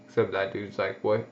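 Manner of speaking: ordinary talk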